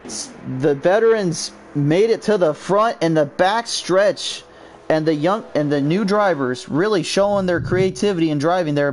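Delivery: high, fast play-by-play of the finish; breathless race commentary; excited race call. Real, not acted. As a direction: call the last lap like it's live. Calls the finish live.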